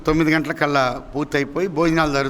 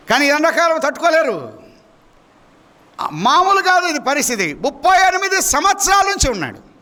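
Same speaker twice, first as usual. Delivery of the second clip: high, insistent pulpit voice; raised preaching cry; emphatic preaching; 8 semitones higher than usual. Real, not acted. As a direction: drive it home to the congregation, pitch climbing. Preacher stresses the long suffering.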